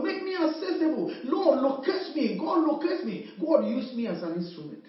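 ordinary delivery

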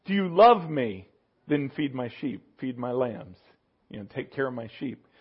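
a quiet, lightly voiced Lord; the Lord speaking. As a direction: tenderly ask and instruct. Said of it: "when the Lord said".

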